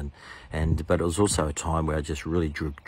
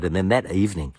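normal talking voice

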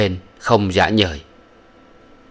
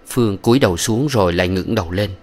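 ordinary delivery

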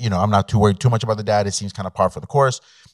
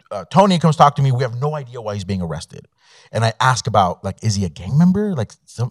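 ordinary talk, nothing marked

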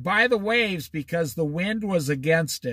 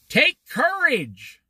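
higher, slow, drawn-out exclamation; emphatic exclamation; 7 semitones higher, slower than usual. Retold or imitated. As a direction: proclaim it firmly as a quoted command. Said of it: "Explanation point".

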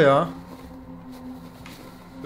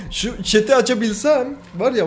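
laughing; 6 semitones higher than usual. Real, not acted. Laughing, near crying.

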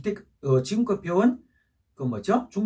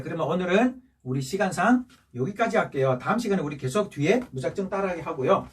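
normal talking voice

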